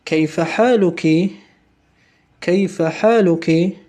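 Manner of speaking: کیف حالکی said slowly, stress and a rise on حالکی; slow Arabic model. Shown as a demonstration. Teacher models the greeting for students to repeat, slow. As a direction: ask کیف حالکی slowly, lifting at the end. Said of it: "و اعد، تکرار کن".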